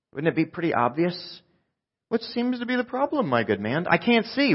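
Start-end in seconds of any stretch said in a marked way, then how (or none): 2.1-3.9 high-pitched, oblivious mock helper; playing dumb. Mock obtuse Jesus playing dumb. 3.9-4.5 high-pitched blind man; mock exasperated reply. Mock blind man's obvious reply.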